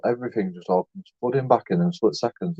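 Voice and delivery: ordinary delivery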